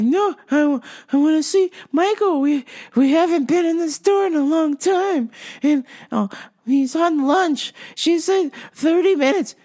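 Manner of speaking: high, whiny-insistent customer imitation. Customer insisting to husband on waiting, high and insistent.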